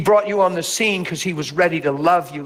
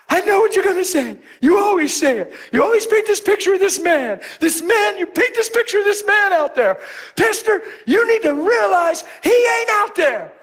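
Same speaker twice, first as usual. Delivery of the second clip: imitating her snapping. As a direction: play the girl snapping at her pastor. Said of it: "she snapped on me".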